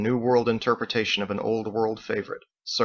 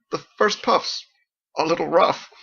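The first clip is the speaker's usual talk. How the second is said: snickering; 6 semitones higher than usual. A narrator's reading. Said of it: "he snickered at his own explanation".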